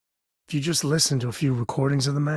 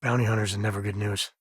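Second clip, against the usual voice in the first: normal talking voice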